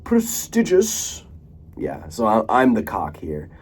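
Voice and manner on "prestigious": prestigious drawn out high as pres-TIDGE-us; high, slow prestigious with a short i. Shown as a demonstration. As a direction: slowly show off prestigious, rhyming the middle with ridge. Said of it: "I like to say".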